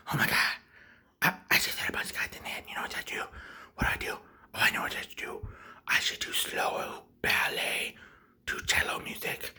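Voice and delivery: hushed, voiceless muttering to oneself; whispered panic. Acted. Shooter alone in locked bathroom, anxious whisper. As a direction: whisper as if hiding.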